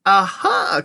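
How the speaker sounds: high, knowing exclamation; high, gleeful interjection; excited cry. A narrator's reading. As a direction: cry it out with relish. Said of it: "cried Mister Wonka".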